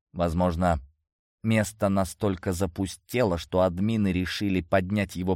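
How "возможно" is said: usual speaking voice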